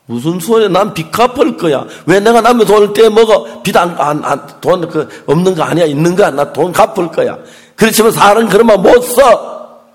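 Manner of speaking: loud, insistent acted scolding; retold indignant refusal. Wife refusing the collector's offer to drop the debt, indignant. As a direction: fire back loudly, rising to a forceful finish.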